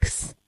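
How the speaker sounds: खस breathed with a rough kh, almost silent; खस said softly as a throaty kh-s cluster; German sound. Shown as a demonstration. Teacher models the German chs sound खस for the kids. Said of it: "pronunciation रहता है".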